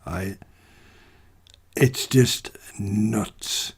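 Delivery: slow, soft, whispered aside; whispered disbelief. Real, not acted. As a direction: breathe it out, stunned. Dismayed closing remark.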